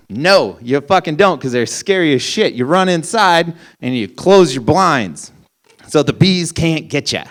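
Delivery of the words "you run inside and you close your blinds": normal talking voice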